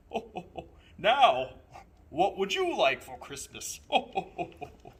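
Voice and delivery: booming jolly Santa Claus. A narrator's reading. Santa calling for the boy from his sleigh, jolly and loud. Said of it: "and he asked".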